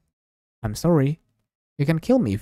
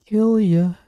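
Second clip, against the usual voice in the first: a higher, sudden eerie threat; menacing killer; 9 semitones higher, 3 dB louder than usual. Acted. Girl stabbing Takuru teases him, higher and eerie. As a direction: creep up with a sing-song threat.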